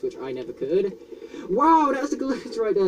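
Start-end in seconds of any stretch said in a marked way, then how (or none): 1.5-2.9 high, slow excited wow; thrilled at the glitch. Real, not acted.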